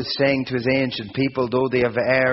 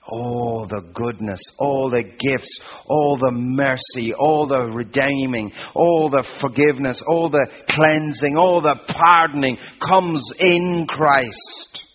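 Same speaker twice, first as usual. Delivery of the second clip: building preaching crescendo; 3 semitones higher, 5 dB louder than usual. Acted. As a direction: preach it up to a full-force climax. Building sermon list.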